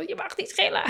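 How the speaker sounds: high, squealing mock telling-off; mock shrieking parent. Parent shrieking at screaming kids, hammed-up high shriek. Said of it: "Misschien ga je zelf gillen".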